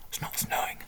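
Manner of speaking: whispered aside; breathy confiding whisper. Real, not acted.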